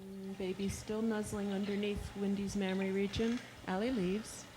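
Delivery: soft, murmured field notes; hushed observation. Real, not acted. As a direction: quietly describe what you see.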